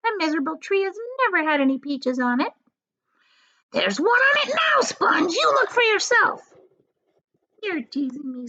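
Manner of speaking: put-on high, sneering old aunt; aunt's voice. Aunt Sponge sneering at her sister, high and mean. Aunts voiced in read story.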